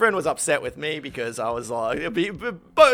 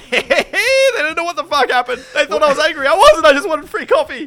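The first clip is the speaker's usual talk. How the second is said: high, loud laughing brag; high giddy triumph, louder; gleeful laughing gloat; 12 semitones higher, 10 dB louder than usual. Pretend. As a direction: cackle about getting free coffee. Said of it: "be like".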